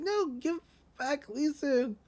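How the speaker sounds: little whining protest, imitating her; pleading. She wants the toy back, mock pleading.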